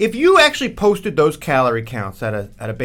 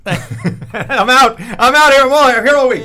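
mock comedian sign-off; 6 semitones higher, 6 dB louder, much slower than usual. Comedian's mock sign-off after joke.

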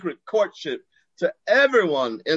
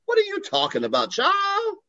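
unconvincing bluff. Retold or imitated. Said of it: "I said, not believing myself".

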